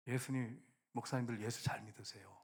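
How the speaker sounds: low, hushed imitation of a sickbed voice; bedridden elderly pastor. Old pastor's last word to young pastors, weak and hushed. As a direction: give the last advice softly and feebly. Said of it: "이제 이렇게 누워 계시는데".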